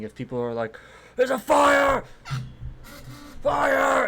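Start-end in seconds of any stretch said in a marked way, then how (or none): 1.2-4.0 high, loud panicked yell of fire; workers shouting fire. Acted. Factory workers raising the alarm, loud panicked shout. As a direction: yell the alarm at the top of your lungs. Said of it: "there's some shouting".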